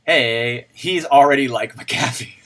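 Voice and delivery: ordinary talk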